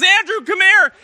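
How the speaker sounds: high, loud shout for help; urgent call. Acted. Simon calling Andrew to the straining net, urgent shout. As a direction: yell to summon him at once. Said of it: "And he goes".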